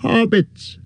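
sudden high exclamation; cried out. A narrator's reading. Innkeeper suddenly remembers, crying out high. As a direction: landlord struck by a thought. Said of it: "he cried".

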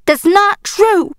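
high, drawn-out denial; upset protest. A narrator's reading. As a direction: cry out a slow, hurt denial. Girl protests hurtful claim.

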